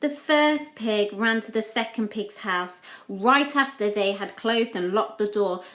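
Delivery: usual speaking voice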